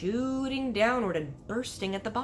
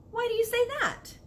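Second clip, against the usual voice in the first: Sophie's voice; 3 dB louder than usual. Acted.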